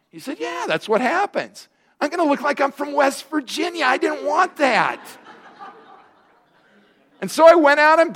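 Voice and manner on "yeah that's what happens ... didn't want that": alarmed high comic whine. Acted. Pastor comically recounting fear.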